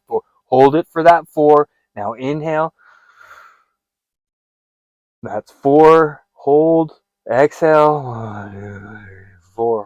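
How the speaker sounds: loud, firm coach counting the breaths; guided breathing exercise. Shown as a demonstration. Coach guiding a panicking viewer, firm loud counting. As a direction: drill them through each breath like a coach.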